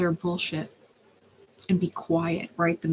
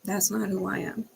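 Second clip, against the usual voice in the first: usual speaking voice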